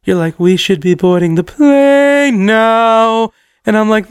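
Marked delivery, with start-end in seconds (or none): 0.4-3.3 PLANE and NOW stretched out very long; dazed, half-chanted, very slow; stoned slow motion. Retold or imitated. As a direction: drag out PLANE and NOW as if time stopped. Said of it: "when you're this high".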